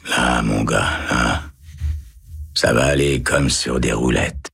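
calm him down gently; soft murmur. A narrator's reading. Kind guard murmuring to a terrified prisoner, low and slow. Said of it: "en murmurant".

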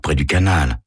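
plain reading voice

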